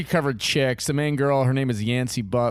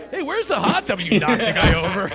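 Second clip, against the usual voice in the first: high, laughing mock disbelief; laughing mock question; 8 semitones higher, 3 dB louder than usual. Friend imitating a buddy's reaction, laughing and high. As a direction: blurt it out through a laugh, half-amused. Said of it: "you're like".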